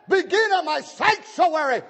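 high, ringing pulpit cry; high-pitched emphatic repeat of scripture; exclaimed preaching. Real, not acted. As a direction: hurl the verse at the congregation.

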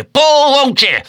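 very high, loud outburst; shrieked order to row; exasperated yell. A narrator's reading. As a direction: explode at the rowers. Stubb bursts out at crew.

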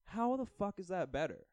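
high-pitched exasperated rant; indignant disbelief. Retold or imitated. Repeats his indignant reaction, now swearing.

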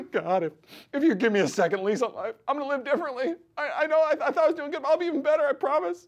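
high, hammed-up tearful begging; desperate pleading. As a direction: bargain frantically in a squeaky mock wail. Acts out bargaining with God, fearing death.

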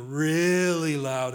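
REALLY stretched long and swelling; stress on REALLY. Real, not acted. Preacher warning about heaven's volume, stretched stress on REALLY. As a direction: lean hard into a long, drawn-out REALLY. Emphasising how loud heaven is.

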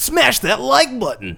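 YouTuber voice. Pretend. Partner hams YouTuber outro.

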